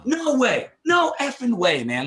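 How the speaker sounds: high, loud cry of disbelief; disbelieving shout. Retold or imitated. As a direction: yell it out like it can't be true. Retold outburst at sudden losses.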